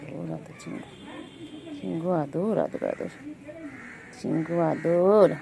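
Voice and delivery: ধীরে, নরম স্বরে বিড়ালকে আদর করে ডাকা; টেনে টেনে মিষ্টি করে ডাকো; আদুরে ডাক. Real, not acted. Cooing to cat slowly. (বিড়ালকে আদর করে নরম স্বরে ধীরে ডাকা)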